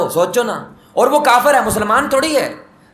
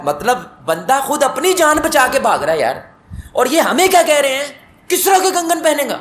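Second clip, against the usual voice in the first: higher, louder, half-laughing disbelief; amazed disbelief; 8 semitones higher, 3 dB louder than usual. Acted. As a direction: marvel that a fugitive promises him a king's bracelets. (حیرت اور ہنسی بھری بے یقینی، اونچی آواز میں)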